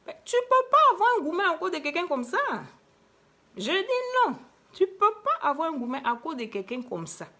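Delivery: high-pitched, hammed-up outrage; indignant protest. Speaker scolds listeners pining over a fleeting lover, indignant protest. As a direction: protest loudly, voice shooting up.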